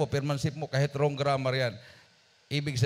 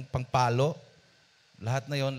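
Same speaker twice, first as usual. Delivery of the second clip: ordinary talk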